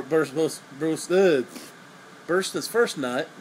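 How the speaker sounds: normal talking voice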